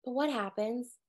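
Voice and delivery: ordinary talk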